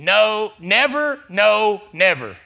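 NEVER punched high and loud; emphatic double negative. Shown as a demonstration. Pastor stresses the Greek double negative. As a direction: hammer "No, never, no, never". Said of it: "So it emphatically is saying".

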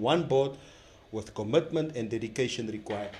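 reading an official press statement; solemn, deliberate statement delivery; formal statement reading. Acted.

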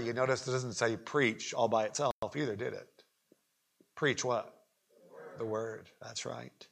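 ordinary talk, nothing marked

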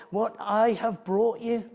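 ordinary talk, nothing marked

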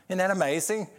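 ordinary talk, nothing marked

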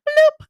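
high, loud cartoon bloop; imitated plop sound. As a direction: pop out a sudden high-pitched plop noise. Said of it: "You're just gonna hear".

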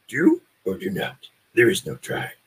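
small wise alien creature voice, halting phrases; halting, creaky sage creature voice; Yoda. Acted. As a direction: croak it out like the little green Jedi master. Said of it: "Can't, can't go wrong with a Yoda".

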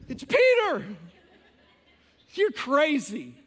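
high laughing protest, then slow scoff; laughing insistence. Acted. Rhoda insists, believers scoff.

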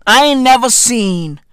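ordinary talk, nothing marked

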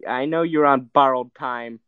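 ordinary talk, nothing marked

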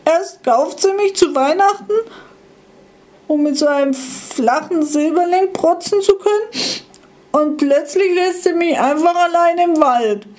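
small abandoned device protesting defiantly; defiant little gadget. Acted. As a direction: stand up to it, hurt and defiant. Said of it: "trotzig aufgestellt".

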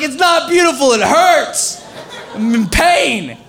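high, loud, hammed-up whine through laughter; comic pained wail, laughing; laughing mock pain. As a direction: laugh while overplaying the hurt. Eyes hurting, laughing while retelling.

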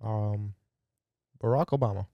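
halting, lightly voiced president; low presidential impression with a hesitant pause; Barack Obama. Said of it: "Did I do my Obama last time?".

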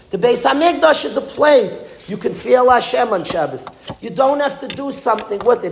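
high-pitched, emphatic sermon delivery; impassioned preaching. Real, not acted. Rabbi telling listeners Yom Kippur needs no packaging, impassioned preaching. Preaches the moral with raised intensity.

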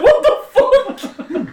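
high-pitched incredulous squawk; stunned outburst. Retold or imitated. Employee retelling being cursed at by coworkers, stunned. Acting stunned reply to an insult.

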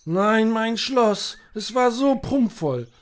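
very slow, loud, high wail of grief; loud lament. Acted. Knight mourns his ruined castle to his companions: slow loud lament. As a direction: drag out the wail over the lost castle.

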